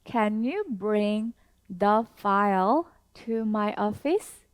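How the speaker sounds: very slow, each word spaced out; slow teacher demo with a rising question end; slow model sentence. Shown as a demonstration. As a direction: model it slowly for learners to repeat. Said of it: "다시 한 번 더".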